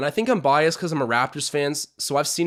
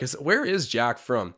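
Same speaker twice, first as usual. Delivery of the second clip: ordinary delivery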